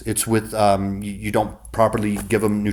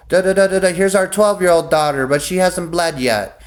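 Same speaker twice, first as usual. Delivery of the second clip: higher, eager sales-pitch voice listing daughters; mock Frey offering daughters; 6 semitones higher than usual. The Freys offering daughters to Lord Snow, mock eager pitch. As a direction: hawk each daughter like goods, climbing higher each time. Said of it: "the Freys was like".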